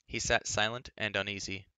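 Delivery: ordinary delivery, nothing marked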